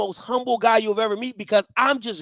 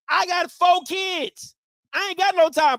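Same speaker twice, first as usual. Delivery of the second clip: ordinary talk, nothing marked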